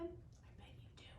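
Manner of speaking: a hushed, secretive whisper. Real, not acted. Teacher whispers to the children about the bear, a hush. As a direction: lean in and whisper it. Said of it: "teller whispers to children".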